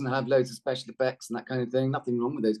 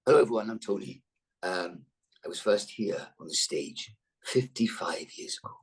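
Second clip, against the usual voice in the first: Tony; 5 semitones lower than usual. Retold or imitated. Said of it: "I'm gonna make a little speech".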